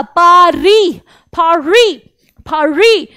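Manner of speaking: पारी said as pa-ree with no final s; Paree not Paris. Shown as a demonstration. Teacher drilling पारी to kids, silent s. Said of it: "पेरिस नहीं बोला".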